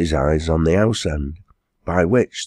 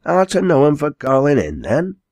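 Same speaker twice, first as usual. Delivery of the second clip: high curious Giles; 9 semitones higher, 3 dB louder than usual. Acted. Giles hailing quarryman.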